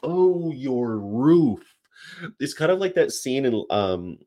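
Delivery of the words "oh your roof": very slow, higher, drawn-out "roof"; dawning realization. Retold or imitated. Finally understanding the Michigan guy, drawn-out "roof" in realization. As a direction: stretch "roof" long as the penny drops. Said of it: "I finally figured this time as roof".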